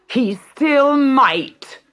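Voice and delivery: high, slow scheming snarl. A narrator's reading. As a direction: sneer it at the daughters. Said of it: "snarled their mother".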